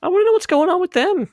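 high nasal listener voice; pinched nasal voice rising high; nasally voice. Pretend. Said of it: "giving them this nasally voice".

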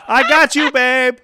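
loud and high singsong; yelling. Retold or imitated. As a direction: belt it at him. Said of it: "everybody just starts yelling".

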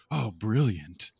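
slow, dry sarcastic praise. Retold or imitated. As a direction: drag it out, deadpan sarcasm. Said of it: "And you're like".

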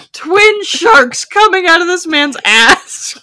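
loud, slow disbelief; incredulous. Real, not acted. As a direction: spell out the absurdity, loudly.

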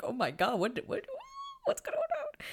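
high, stammering viewer freak-out; hammed-up alarm shooting up in pitch; mock panic. Acts out viewers' shocked reaction.

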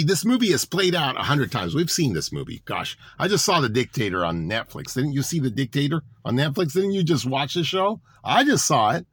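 ordinary talk

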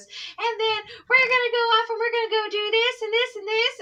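high, rushing imitation of a kid; excited child's rant. Teacher imitating pupil reeling off his plans, excited rant. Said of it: "exactly saying it like that".

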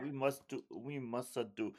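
mock a terrible fake Italian accent; bad Italian accent. Massimo pulling Steve aside, mocked in bad Italian accent. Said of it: "the worst Italian accent you've ever heard".

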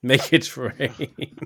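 slow drawn-out echo through a laugh. Real, not acted. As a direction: savour the phrase while laughing. Repeating guest's sign-off, amused.

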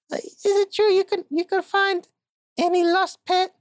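a desperate client pleading; frantic woman. Acted. Woman client begs the detective for help, high and squeaky.

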